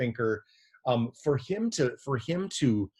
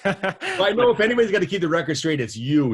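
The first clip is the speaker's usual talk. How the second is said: higher, louder and fast, laughing through the words; laughing while talking; 9 semitones higher, 9 dB louder than usual. Real, not acted. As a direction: talk through a laugh, teasing. Laughing along at the slip.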